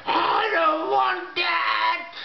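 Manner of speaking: a high, drawn-out, frightened refusal; frightened shout. Retold or imitated. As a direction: cry out to refuse the implant. Refuses the implant.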